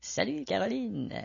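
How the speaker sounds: soft greeting. Pretend.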